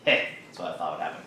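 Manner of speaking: low, quick and quiet; muttered aside. Acted. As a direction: mutter it fast under your breath. Droid overheard, mutters in reply.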